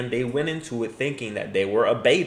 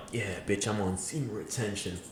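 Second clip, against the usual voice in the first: hushed smug creep voice; 4 dB quieter than usual. Retold or imitated. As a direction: purr it like a self-satisfied creep. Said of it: "And you're like".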